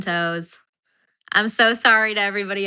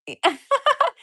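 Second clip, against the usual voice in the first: very high, rising shriek of a laugh; laughing shriek at lost job; 3 dB louder than usual. Real, not acted. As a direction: squeal a laugh out. Laughing at her own bad day.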